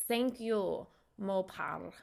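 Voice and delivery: careful put-on Russian accent; Russian-accented thanks, each word placed. Shown as a demonstration. Streamer thanking a viewer, Russian accent. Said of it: "in my Russian accent today".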